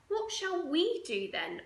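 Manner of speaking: put-on high, slow child's question; a bored little girl asking; slow high-pitched whiny kid voice. Child asks mother.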